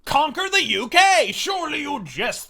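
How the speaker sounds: a hint of a chortling posh Brit; mocking snooty Brits. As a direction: sneer it like a smug Englishman. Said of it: "they chortled".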